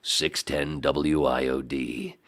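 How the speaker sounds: low, slow station ID; radio station announcer. Retold or imitated. Imitating the station heard from the van, announcer voice. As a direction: draw out the call letters like an announcer. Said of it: "I hear this".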